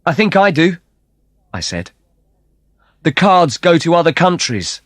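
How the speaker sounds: high, loud confident reply; bold answer. Acted. [name] cuts in before Kate, answering Antonio high and bold. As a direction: cut in boldly.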